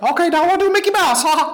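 very high cartoon mouse falsetto; Mickey Mouse. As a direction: squeak it like a cheery cartoon mouse. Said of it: "impressions of cartoon characters".

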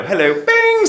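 high, loud, showy British greeting. Acted. Using British on strangers to impress them, showy British. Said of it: "you use British instant six".

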